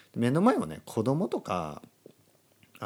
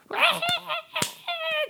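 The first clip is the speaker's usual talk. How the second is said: long falsetto roar of laughter; hammed-up high cackling laugh; audience laughter imitation; much slower than usual. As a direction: imitate a stand-up crowd in hysterics. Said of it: "オーディエンスみたいな".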